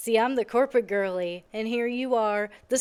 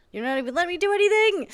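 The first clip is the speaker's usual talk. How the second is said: exasperated outburst; 10 semitones higher, 7 dB louder, faster than usual. Real, not acted. Game won't let her act.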